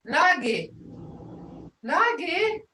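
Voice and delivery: Nagi falling, then Nagi rising high; tone demo. Shown as a demonstration. Teacher answering Desiree with the tones. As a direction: drop the first Nagi, lift the second. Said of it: "I can say".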